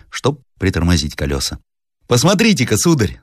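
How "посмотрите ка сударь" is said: higher-pitched servant's remark; Egor the servant. Acted. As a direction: lightly play a servant pointing something out. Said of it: "сказал Егор". (слуга обращает внимание барина)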